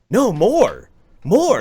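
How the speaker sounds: high, loud egging on; urging. Retold or imitated. Urging him to drink more.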